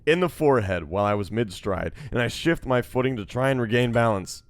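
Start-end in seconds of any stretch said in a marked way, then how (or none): none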